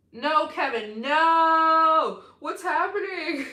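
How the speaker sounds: loud, high disbelieving yell; shocked shout. Real, not acted. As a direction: cry out in shock. Stunned by huge gift.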